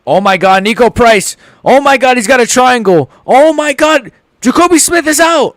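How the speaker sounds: very high, loud stunned shouts; shocked yelling. Real, not acted. Watching a submission finish live, screaming in shock. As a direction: scream it as the fight ends.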